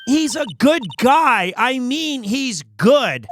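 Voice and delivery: high, emphatic character voice; earnest insistence. Acted. Game assistant insisting the client is innocent, earnest and high.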